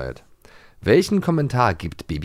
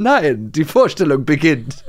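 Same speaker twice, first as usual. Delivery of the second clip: mayor impression; 4 semitones higher, 4 dB louder, slower than usual. Voicing mayor, laughing.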